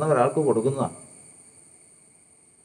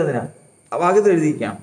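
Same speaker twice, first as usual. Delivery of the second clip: normal talking voice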